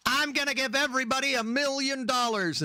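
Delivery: high, grandly promising politician voice; campaigning president. Retold or imitated. Mocking a president's campaign promise. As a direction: promise big like a candidate. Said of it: "they're like".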